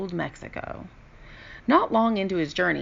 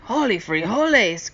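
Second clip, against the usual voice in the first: exclamation; 4 semitones higher, 4 dB louder than usual. A narrator's reading. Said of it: "cried Skipito".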